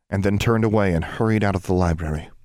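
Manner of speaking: usual speaking voice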